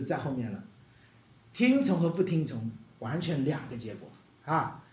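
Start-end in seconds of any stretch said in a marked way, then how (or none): none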